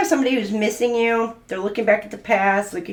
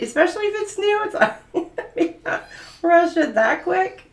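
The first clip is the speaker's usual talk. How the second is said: laughing; 9 semitones higher than usual. Real, not acted. Laughing at rushing a proposal.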